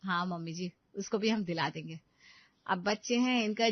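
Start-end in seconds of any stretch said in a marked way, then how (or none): none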